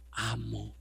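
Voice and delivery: soft, low "amo" after the shouting; hushed. Shown as a demonstration. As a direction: drop the voice on "amo" in humility. Humble word said softly. ("amo" dicho en voz baja y humilde)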